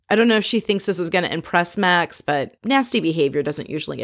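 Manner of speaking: normal talking voice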